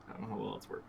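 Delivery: ordinary talk, nothing marked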